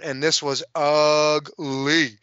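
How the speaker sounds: slow, dragged "ugly" with a falling finish; leaning hard on "ugly", very slow; drawn-out ugly. Real, not acted. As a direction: stretch "ugly" out to savor how bad it was. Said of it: "I mean ugly with a capital U".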